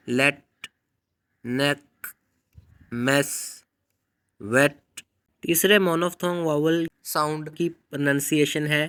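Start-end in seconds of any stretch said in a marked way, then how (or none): 0.1-4.8 लेट, नेक, मेस, वेट with the short e of let; teacher demo of short e; short e words. Shown as a demonstration. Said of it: "For example".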